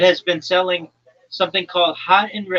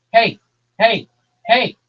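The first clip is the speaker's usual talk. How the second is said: sharp, louder hey to cut in; loud call for attention; 5 semitones higher, 7 dB louder than usual. Real, not acted. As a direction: cut through the chatter. Calls out to interrupt listener.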